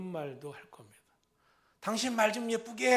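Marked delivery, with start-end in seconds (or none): 1.8-2.9 loud, higher scolding; sharp rebuke. Retold or imitated. Spouse scolding a partner's harsh words, loud. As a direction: snap it at your spouse.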